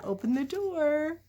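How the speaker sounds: ordinary delivery, nothing marked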